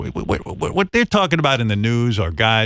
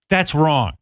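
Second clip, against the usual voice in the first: normal talking voice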